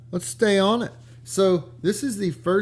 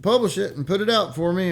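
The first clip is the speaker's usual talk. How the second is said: higher and louder, breezy mock offer; hammed-up innocent author voice, raised and loud; mock Hemingway handing it over; 7 semitones higher, 5 dB louder than usual. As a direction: toss the manuscript over with fake innocence. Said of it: "Horace Liveright and said".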